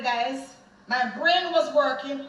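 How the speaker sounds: ordinary talk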